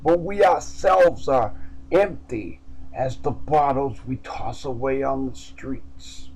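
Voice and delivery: usual speaking voice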